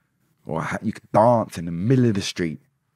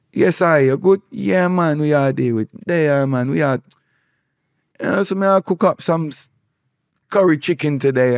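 higher, lively Jamaican patois; Jamaican street chat; 6 semitones higher than usual. Acted. Locals greeting each other on the street, lively Jamaican patois. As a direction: call out a friendly Jamaican greeting.

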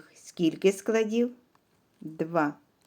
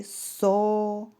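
сова drawn out as со-ва, loud and slow; syllable by syllable; 4 semitones higher, 4 dB louder than usual. Shown as a demonstration. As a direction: split сова into two long beats: со, ва. Teacher stretches word into syllables.

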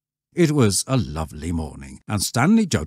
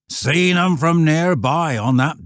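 loud, high rustic voice; insistent old shepherd; 10 semitones higher, 6 dB louder than usual. Acted. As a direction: countryman vouching with force. Shepherd insists he saw them.

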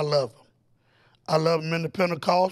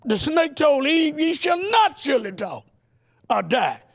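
preaching at full force, higher and driving; fiery preaching; 4 semitones higher, 3 dB louder than usual. Acted. Preacher warning the congregation against snake talk, fiery preaching. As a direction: preach it to the congregation, building fire.

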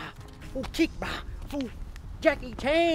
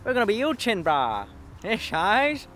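loud eshay lad voice, Aussie; brash eshay Prime Minister; aussie eshay slang; 4 dB louder than usual. Acted. Comedian plays eshay prime minister.

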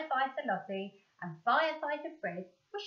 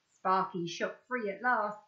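usual speaking voice

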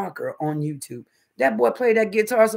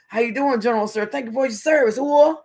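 starstruck Rodman; 6 semitones higher, 4 dB louder than usual. Retold or imitated. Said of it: "Colin Powell was like".

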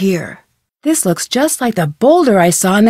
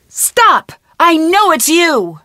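shout; 6 semitones higher, slower than usual. Real, not acted. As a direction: yell to stop the culprit. Calling out the monster.

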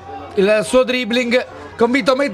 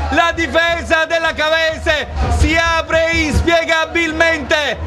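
breathless italian goal commentary; 4 semitones higher than usual. Real, not acted. Goal call in live commentary.

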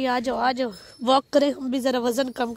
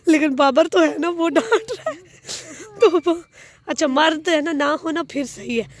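ordinary talk

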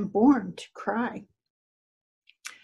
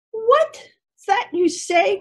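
high, slow, dread-filled cry; the donkey cries out in dread, much higher and slowed; cried with dread; 9 semitones higher, 4 dB louder, slower than usual. A narrator's reading. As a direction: gasp it out in dread. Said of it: "cried the donkey with dread".

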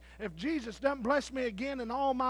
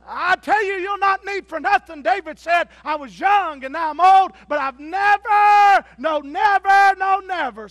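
high, loud, hammering preacher cry; preaching shout; 10 semitones higher, 18 dB louder than usual. Real, not acted. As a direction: shout it out to the congregation, stretching never. Preacher building to a climax.

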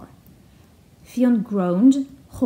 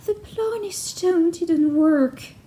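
sad banshee voice; 6 semitones higher, slower than usual. Acted.

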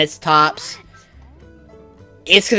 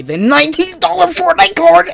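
excited shout; 13 semitones higher, 6 dB louder than usual. Real, not acted. Finds a surprise item.

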